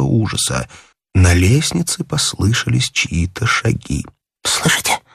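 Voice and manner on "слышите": terrified whisper; breathy, hushed fright; moaning in fear. A narrator's reading. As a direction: whisper it in dread. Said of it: "простонал он".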